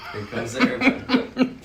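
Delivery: high, slow, sing-song feeding voice; airplane spoon game. Pretend. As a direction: coax him like feeding a toddler. Feeding camera guy by spoon.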